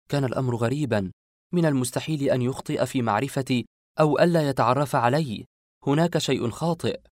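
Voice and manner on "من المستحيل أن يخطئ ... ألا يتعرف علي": plain reading voice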